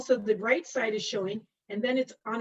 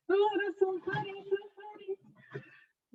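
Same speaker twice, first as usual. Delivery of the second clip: laughing; 10 semitones higher than usual. Real, not acted. Teacher laughing at student's joke.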